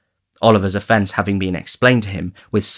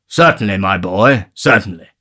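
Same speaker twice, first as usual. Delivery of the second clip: lightly voiced pompous gentleman; a hint of a benign old gentleman; 6 dB louder than usual. A narrator's reading. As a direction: patronise the boy, a bit louder.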